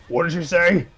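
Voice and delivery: high, loud puzzled question; Godzina's voice. A narrator's reading. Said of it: "asked Godzina".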